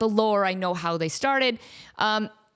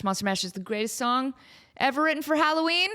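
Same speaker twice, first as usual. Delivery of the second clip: usual speaking voice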